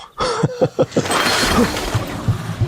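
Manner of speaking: very high, breathy, otherworldly laugh; a monster's laugh breaking out; eerie high laugh. Acted. Hostage child transforming, eerie high breathy laugh.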